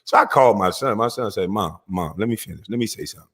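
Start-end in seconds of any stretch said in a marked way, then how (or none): 1.5-3.3 soft, lower imitation of the son; son pleading gently. Son asking his mom to let him finish, softly pleading. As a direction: quietly try to get a word in. Son tries to get a word in.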